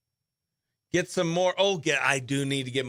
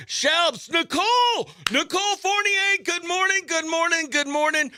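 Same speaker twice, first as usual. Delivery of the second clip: much higher singsong good mornings; excited greetings; 12 semitones higher than usual. Real, not acted. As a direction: greet the chat brightly.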